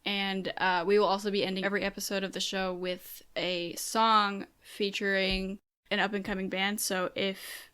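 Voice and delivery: podcast announcement read. Real, not acted. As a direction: read the outro notice evenly. Post-edited announcement read.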